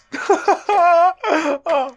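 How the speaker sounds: high, delighted laughter; gleeful laughing; joyful laugh. Acted. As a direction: laugh in happy disbelief. Laughing at the success.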